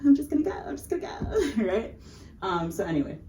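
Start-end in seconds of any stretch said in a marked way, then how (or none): 0.0-1.6 whispered, rushed retreat; nervous whisper. Retold or imitated. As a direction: back away whispering. Tourist sneaking away.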